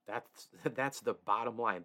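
usual speaking voice

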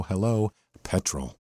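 ordinary talk

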